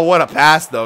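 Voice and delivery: usual speaking voice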